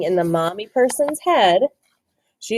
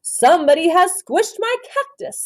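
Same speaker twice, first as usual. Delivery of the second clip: raised, lively father's line; daddy person voice; 9 semitones higher, 4 dB louder than usual. A narrator's reading. Teacher voicing the daddy person to the class, mildly indignant. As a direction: read the dad's complaint with a lift. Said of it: "said the daddy person".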